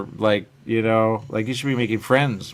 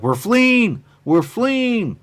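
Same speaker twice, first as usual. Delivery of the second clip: much higher, louder frantic cry; panicked crying out; 13 semitones higher, 6 dB louder, much slower than usual. Retold or imitated. The people leaving their world, fleeing in panic, loud. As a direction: shout it like a panicked crowd. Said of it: "they were really like".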